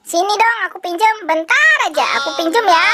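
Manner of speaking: put-on bright high pushy boy voice, pitched well up; a cheeky boy begging for toys; cheeky kid voice; high cartoon kid.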